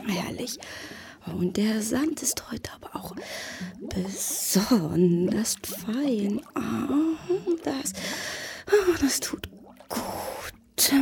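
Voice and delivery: soft, breathy, contented murmur; blissful whisper. Acted. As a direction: sink into pleasure, almost whispering. Fish relaxing in fine sand.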